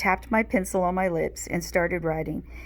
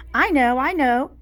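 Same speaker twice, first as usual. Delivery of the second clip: higher, louder eager shout; 7 semitones higher, 8 dB louder than usual. A narrator's reading. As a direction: call out with hand waving wildly. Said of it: "Max shouted".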